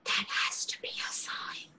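hushed whisper to herself; awed aside, whispered under her breath. Acted. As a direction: breathe it so he can't hear. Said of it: "Sorry, I, I didn't catch that".

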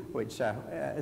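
ordinary delivery

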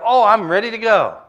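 spring out of bed raring to go; eager morning person. Retold or imitated. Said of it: "Or you wake up".

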